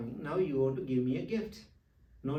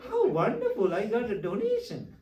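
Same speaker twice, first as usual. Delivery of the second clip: much higher and louder, laughing with joy; laughing delight; 7 semitones higher, 4 dB louder than usual. Retold or imitated. Monk recalling finding twenty bucks, laughing joyfully. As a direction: gush over the twenty bucks like a windfall. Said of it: "I was so happy".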